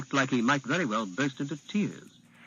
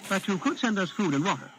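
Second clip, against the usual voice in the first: put-on higher, polite young prince; boy prince voice; 6 semitones higher than usual. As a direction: sound like a poised child royal.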